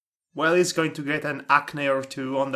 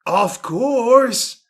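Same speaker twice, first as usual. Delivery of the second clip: a touch of macho swagger, louder; 5 semitones higher, 6 dB louder than usual. Acted. Males answers the woman agent with light macho swagger. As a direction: puff up like a tough guy. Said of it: "said Males all macho-like".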